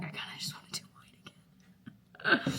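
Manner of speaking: soft whispered confession. Retold or imitated. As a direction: breathe it like a guilty secret. Confessing her usual choice, whispered.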